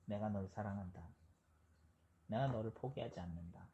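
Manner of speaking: hushed tender assurance from God; God's inner voice. Retold or imitated. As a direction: speak like a gentle voice in the heart. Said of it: "마음의 음성".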